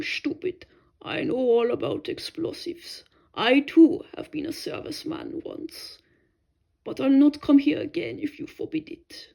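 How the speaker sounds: put-on an old German monk, gentle and earnest, a hushed confession in the middle; lightly voiced foreign monk, sincere and calm. A narrator's reading. Old German monk speaks to narrator.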